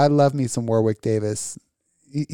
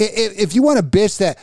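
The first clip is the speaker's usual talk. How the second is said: ordinary delivery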